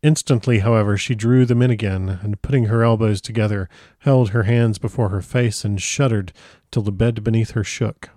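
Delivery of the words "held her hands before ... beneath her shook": usual speaking voice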